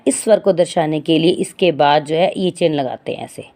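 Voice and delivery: usual speaking voice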